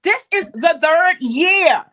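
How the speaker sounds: loud, high exclamation; excited amazement. Real, not acted. Host marvels with co-host at the third year, loudly excited. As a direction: call it out in happy disbelief.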